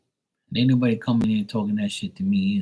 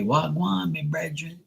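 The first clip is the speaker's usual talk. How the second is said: high, softer Jamaican imitation; Jamaican accent; 6 semitones higher, 6 dB quieter than usual. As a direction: mimic a Jamaican greeting in a raised, softer voice. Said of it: "But they say".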